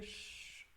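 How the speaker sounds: soft "shhh"; imitated hissing noise. Shown as a demonstration. Teacher showing the sound inside the word, imitated hiss. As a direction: let out a voiceless hiss. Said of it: "já inclui esse som".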